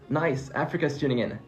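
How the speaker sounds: normal talking voice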